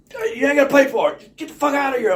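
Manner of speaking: loud, high retold yell; hammed-up angry shout; mock shouting clerk. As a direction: bellow it like a gruff shopkeeper. Clerk voiced, much louder.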